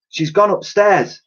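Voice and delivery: put-on high, slow girlish voice; girl spotting footprints, high and slow; girl's voice. A narrator's reading. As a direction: say it like an eager little girl. Girl character voice.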